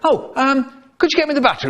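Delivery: high, odd put-on voice; silly high voice. Dad at tea time asking for milk in a high voice.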